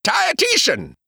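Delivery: sudden high outburst; blurted out. Acted. Oates interrupting Pa, sudden high outburst. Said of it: "he blurted out".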